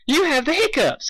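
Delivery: high, giggly pony voice; giggling Pinkie. Acted. Pinkie teasing Spike about his hiccups, high and giggly. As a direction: tease him with a high giggle. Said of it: "giggled Pinky".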